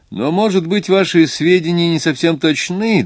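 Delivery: high-pitched, insistent probing; pressing. A narrator's reading. Doctor questions the watchmaker's information, pressing. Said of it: "допытывался доктор".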